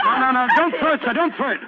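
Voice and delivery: loud, pleading no no no; shouting to stop the throw; urgent shout. Acted. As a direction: stop him before he throws. Watson stops the spear throw.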